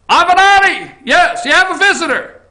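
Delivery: high, loud guard calling a name; mock jail guard call. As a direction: call out like a jailer.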